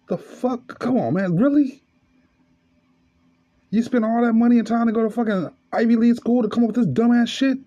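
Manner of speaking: high, incredulous outburst; exasperated rant. Real, not acted. Comic ranting at Ivy League lawmakers, high and indignant. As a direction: let it fly in disbelief. Ranting at gun reform politicians.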